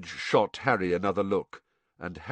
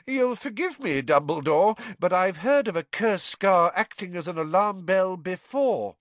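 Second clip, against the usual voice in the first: high, stubborn minister voice; stubborn Fudge; 9 semitones higher than usual. A narrator's reading. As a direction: dismiss it, polite but stubborn.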